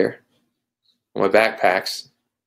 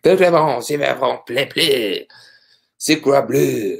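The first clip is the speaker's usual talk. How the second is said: fake French; 4 semitones higher than usual. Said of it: "That was my bad French, fake French there".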